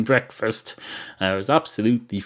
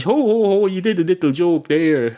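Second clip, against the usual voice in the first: high, teasing "ho ho ho" delivery; jolly barman ribbing, pitched well up; mock chuckle; 10 semitones higher, 4 dB louder than usual. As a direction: tease them with a put-on laugh. Barman teasing cat about its joke.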